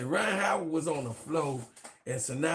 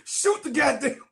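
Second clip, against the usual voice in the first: mock coach yell; 7 semitones higher, 3 dB louder than usual. Calling out coach's order.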